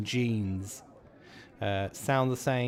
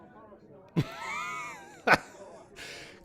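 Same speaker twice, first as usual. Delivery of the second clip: long, very high-pitched laugh; real laugh. Real, not acted. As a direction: laugh helplessly, squealing high. Laughs during the quiz.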